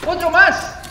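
loud, high cry of disbelief; dismayed surprise. Real, not acted. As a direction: exclaim in sudden alarm. Another monster appears unexpectedly.

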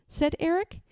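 ordinary delivery

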